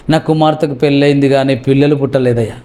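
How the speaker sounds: low-pitched, mildly woeful mother; mock tearful lament. Mother lamenting her childless daughter, understated tearful lament. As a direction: act a subdued weeping complaint. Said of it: "మరలా ఏడుస్తుంది".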